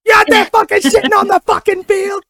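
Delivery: high, loud hammed-up dad tirade; mock yelling dad. Dad yelling at his son on the field, mock furious. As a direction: bellow like Bill Burr chewing out his son. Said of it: "Yell at his son like that".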